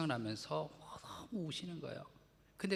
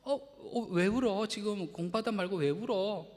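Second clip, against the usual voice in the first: flustered husband; 6 semitones higher, 5 dB louder than usual. Retold or imitated. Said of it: "그래서".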